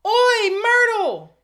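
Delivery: huge high drawn-out yell; bellowing. A narrator's reading. Peeves calling Myrtle over, loud drawn-out bellow. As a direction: bellow it across the room, stretched long. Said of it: "took a deep breath and bellowed".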